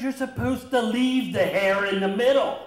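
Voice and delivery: high, loud exasperated explanation; indignant. Retold or imitated. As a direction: insist he has it backwards. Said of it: "he goes".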